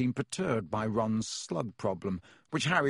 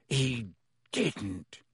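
growling. A narrator's reading. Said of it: "he growled at Hermione".

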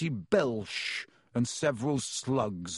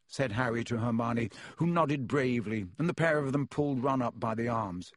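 usual speaking voice

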